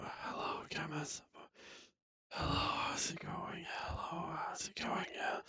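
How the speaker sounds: soft unvoiced whisper into the mic; mic test whisper. Shown as a demonstration. Friend testing the noise gate, whispering. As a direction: breathe the words, barely any voice. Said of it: "you wanna talk as quiet as possible".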